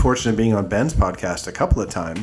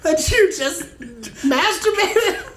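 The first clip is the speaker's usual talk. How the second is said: high, slow words squeezed through laughter; can barely get it out for laughing; laughing hard. Real, not acted. Host cracking up at cohost's vagina remark.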